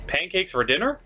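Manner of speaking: normal talking voice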